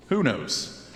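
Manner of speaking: ordinary delivery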